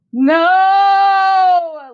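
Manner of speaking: explosive roar of no, high and loud; roaring no as he transforms; guttural explosion. A narrator's reading. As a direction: monster erupting out of him. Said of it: "Lennon's voice is an explosion, deep and guttural".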